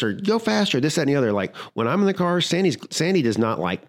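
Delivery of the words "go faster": high, pushy passenger command; mock impatient order. Passenger pushing the driver, mock impatient. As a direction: bark it like an impatient spouse. Couple fighting in the car.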